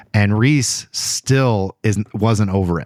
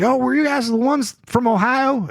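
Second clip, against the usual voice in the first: put-on thick Southern accent; 13 semitones higher than usual. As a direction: ask it as an imitated Tennessee Southerner, voice pitched high. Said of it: "I mean, thick accent".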